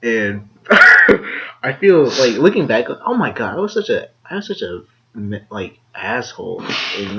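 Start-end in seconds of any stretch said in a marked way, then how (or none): 0.7-1.6 high, breathy embarrassed squeal; squealing laugh. Real, not acted. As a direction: let out a shrieking embarrassed laugh.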